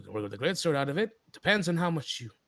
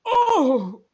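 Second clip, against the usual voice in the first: startled oh; 11 semitones higher, 10 dB louder than usual. Acted. GM startled exclamation.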